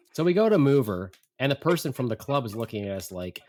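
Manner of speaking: ordinary delivery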